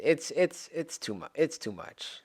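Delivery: overwhelmed fading murmur; trailing off. Real, not acted. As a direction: let it die away, defeated. Fading out in sadness.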